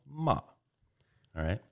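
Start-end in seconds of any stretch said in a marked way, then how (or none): 0.1-0.4 "ma" starting high and dropping sharply; "ma" in a Mandarin falling tone; falling fourth tone. Shown as a demonstration. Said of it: "the fourth tone is falling".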